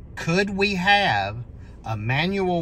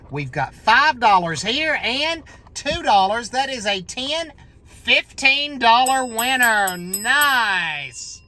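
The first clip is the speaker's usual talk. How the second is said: raised, rising excitement over a win; excited announcement; 8 semitones higher, 5 dB louder than usual. Real, not acted. Player adding up a fifteen dollar winner, excited announcement. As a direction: announce the prize high and delighted. Reads out his winnings.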